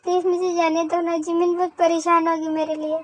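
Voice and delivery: soft, tearful begging; tearful pleading. Acted. Frightened girl begging to be let go, tearful pleading. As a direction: plead quietly through tears. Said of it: "तू रोती भी है".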